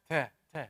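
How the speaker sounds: ta with a puff of released air. Shown as a demonstration. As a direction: breathe air out on each ta. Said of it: "So you cannot say it".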